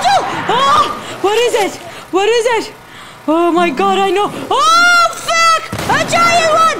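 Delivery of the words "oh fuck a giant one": high, loud frightened cries; real fright at a huge monster; alarmed shouting. Real, not acted. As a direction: yell the warning in alarm. A giant monster appears.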